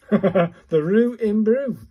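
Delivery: amused repeat; laughing. Real, not acted. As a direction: chuckle through the words. Laughs at sound.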